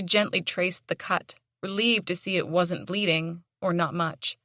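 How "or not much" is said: usual speaking voice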